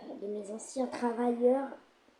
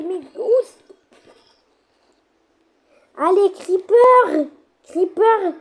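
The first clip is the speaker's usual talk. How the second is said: high, loud shouting of orders; commanding shout; 8 semitones higher, 10 dB louder than usual. Acted. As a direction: yell at your troops to attack. Shouting orders at his creepers.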